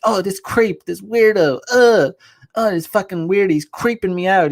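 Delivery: exaggerated creeped-out complaint; mock disgust. Man mimicking a woman reacting to a harmless hello, mock-disgusted.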